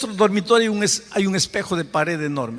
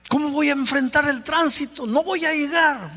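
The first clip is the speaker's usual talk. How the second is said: agitado y más agudo, preocupado por llegar tarde; nervioso; 10 semitones higher than usual. Retold or imitated. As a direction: como un padre con prisa que ve el reloj. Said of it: "Me comencé a poner nervioso". (voz nerviosa y aguda por el tránsito)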